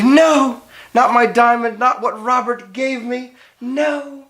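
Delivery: high, anguished refusal; cling to the ring, panicking; distressed protest. Acted. Bride refuses to give ring.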